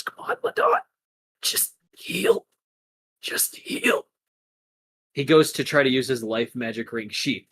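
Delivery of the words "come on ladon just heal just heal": strained, breathless self-urging; barely conscious. Acted. Ladon urging himself to heal, barely conscious. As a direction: force it out with barely any voice. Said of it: "He is barely conscious".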